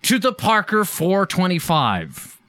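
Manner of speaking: slow, high, grand title announcement; announcer voice. Acted. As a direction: announce it like a big show. Announcing the show's segment title.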